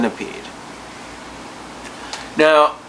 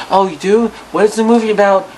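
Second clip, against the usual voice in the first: high, excited porn star; 7 semitones higher, 3 dB louder, faster than usual. Retold or imitated. Porn star voiced in phone call.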